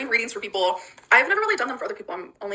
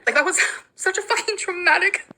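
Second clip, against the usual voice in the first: tearful; 10 semitones higher than usual. Real, not acted. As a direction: voice cracking up high. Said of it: "so fucking sad right now".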